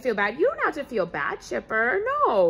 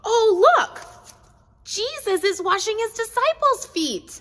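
excited; 8 semitones higher than usual. Pretend. Opening the Bible story.

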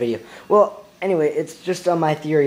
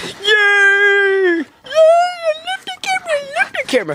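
very high squealing dog voice, louder; dog's voice; 5 dB louder than usual. Pretend. Owner voicing his dog meeting the camera, high squeal. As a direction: squeal with glee as the excited pet.

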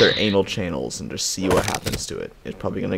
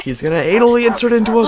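much higher, louder hammed-up panic shout; mock panic yell; 10 dB louder than usual. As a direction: fake terror for laughs.

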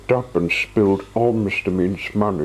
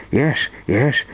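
panicked; 6 semitones higher than usual. Acted. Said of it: "he cried in a panic".

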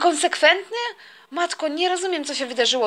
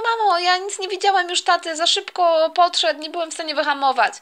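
ordinary talk, nothing marked

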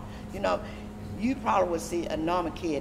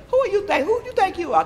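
retold angry challenge, much higher and louder; imitating angry boss; 14 semitones higher, 5 dB louder than usual. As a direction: play the Secretary scolding her, high and loud. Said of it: "he scared me so bad".

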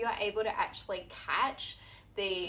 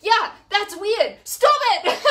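high, loud, slow stern STOP IT; mock yelled command; 11 semitones higher, 14 dB louder, slower than usual. Therapist yelling at his patient, loud and high. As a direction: bark the order at the patient. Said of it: "And he goes".

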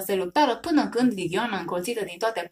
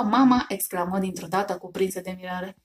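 ordinary delivery

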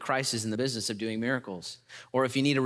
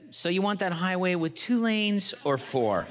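genie; 5 semitones higher than usual. Acted. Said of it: "The genie said".

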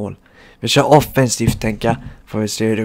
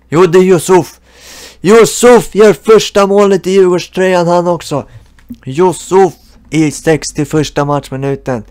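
mock sports commentator; 6 semitones higher, 6 dB louder than usual. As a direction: call the goal like a TV commentator. Calls goal as mock TV commentator.

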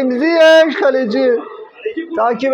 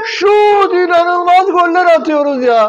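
excited shouting; 6 semitones higher than usual. Real, not acted. Fan reacts to goal.

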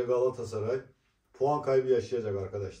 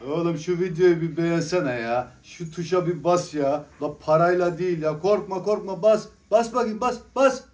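pushy plea building to a high yell; rising shout; 5 semitones higher, 6 dB louder than usual. Real, not acted. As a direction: nag louder and louder till yelling. Urging viewers to press like.

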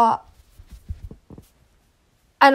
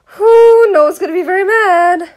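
loud warning; 6 semitones higher, 7 dB louder than usual. Pretend.

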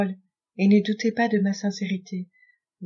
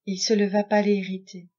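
plain reading voice

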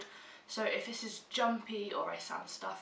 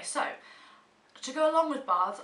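usual speaking voice

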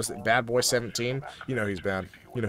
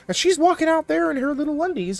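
mock scandalized; 14 semitones higher, 5 dB louder than usual. Imagining sister on camera.

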